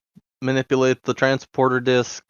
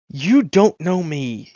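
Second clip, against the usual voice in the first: slow, higher-pitched wounded pride; affronted disbelief; 4 semitones higher, much slower than usual. Acted. Braggart to a creature who doesn't know his name, affronted. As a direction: draw it out, offended.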